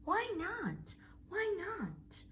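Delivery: high, pleading little voice; a small child begging to know; young Gruffalo child asking why; child's plea. Acted.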